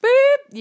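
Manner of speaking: high, loud spoken bleep; imitated censor beep. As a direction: bleep out the swear word yourself. Self-censoring an explicit word.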